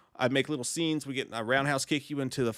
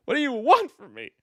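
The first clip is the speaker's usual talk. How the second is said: higher and louder mock plea; mock exasperated cry; 10 semitones higher, 4 dB louder than usual. Shown as a demonstration. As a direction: throw the line up in fake despair.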